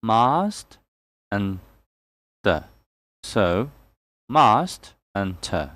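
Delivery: "Mast", pause, then "te", syllable by syllable; split into syllables. Shown as a demonstration. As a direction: break "master" into "mast" and "te". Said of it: "master has two syllables".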